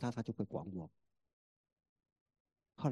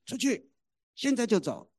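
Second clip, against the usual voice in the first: high, loud barked order; angry command; 10 semitones higher, 6 dB louder than usual. Retold or imitated. Mother ordering her son out now, furious shout. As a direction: snap it like a final ultimatum. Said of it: "何眠就说".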